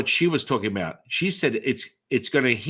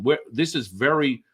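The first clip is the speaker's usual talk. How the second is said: ordinary talk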